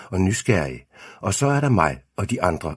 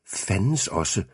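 plain reading voice

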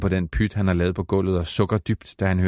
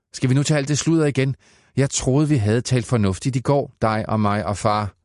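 higher, faster exasperated scolding; fed up with the same nonsense again; mother scolds son over his stories, raised and quick; 5 semitones higher than usual. Acted.

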